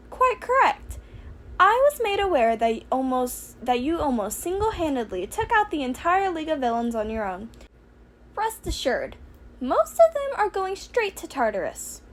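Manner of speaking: high, chirpy little principal voice; Nezu's voice. Acted. Principal welcoming student, high and chirpy. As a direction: lift the voice up small and polite.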